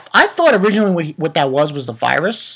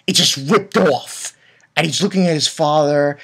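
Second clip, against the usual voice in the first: ordinary talk, nothing marked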